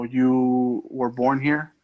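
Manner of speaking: ordinary talk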